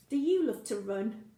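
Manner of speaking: usual speaking voice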